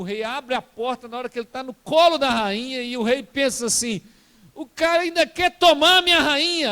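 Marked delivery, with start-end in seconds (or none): 4.5-6.7 higher and louder jealous outburst; outraged king. Retold or imitated.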